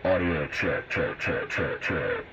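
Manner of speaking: mic check heard through a radio speaker with hiss; a test call coming back over the air. Real, not acted. Radio hobbyist's mic check heard on the bench radio. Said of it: "You can hear the radio coming across the test bench radio".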